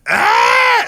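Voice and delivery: high, loud crow-like squawk; short imitated caw of outrage; mock crow screech; upset mom screech. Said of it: "when she gets upset at me".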